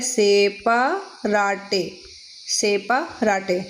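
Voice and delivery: सेपाराटे said slowly, every letter voiced as se-pa-ra-te; सेपाराटे drawn out syllable by syllable, x-slow memory aid; spelling pronunciation. Shown as a demonstration. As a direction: sound out सेपाराटे like it is spelt, slow. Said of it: "मैंने तो ऐसे याद किया है".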